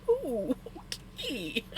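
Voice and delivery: slow, drawn-out Ooh okay; mock taken aback. Imitating the friend's mom, slow and put out. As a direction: stretch it out, caught off guard. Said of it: "the look on her face".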